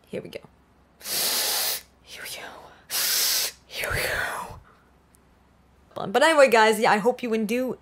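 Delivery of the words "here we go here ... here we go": hushed, voiceless count-in; airy whisper under the breath. Real, not acted. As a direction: whisper it to yourself. Whispers to self between takes.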